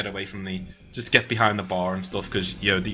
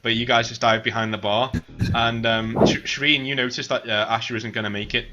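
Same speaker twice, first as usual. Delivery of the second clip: ordinary talk, nothing marked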